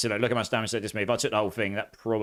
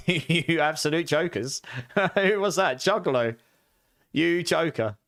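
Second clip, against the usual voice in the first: slow, laughing, higher; laughing scold; 5 semitones higher, slower than usual. Real, not acted. To chat jokers disrupting him: laughing. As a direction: tease the chat while laughing.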